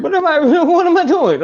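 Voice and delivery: high, laughing self-mockery; laughing at how worked up he got; laughing at himself. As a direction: catch yourself and laugh at it. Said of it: "and you're like".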